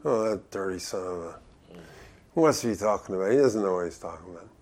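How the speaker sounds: low, quick, snide mumble; snide grumbling. Retold or imitated. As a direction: grumble it like a sneering bystander. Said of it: "you know, in a snide way".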